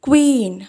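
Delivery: ക്വീൻ said kween with a clear w after the k; correct pronunciation. Shown as a demonstration. Teacher modelling the right way to say ക്വീൻ. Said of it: "ഇതിൻ്റെ യഥാർത്ഥ pronunciation".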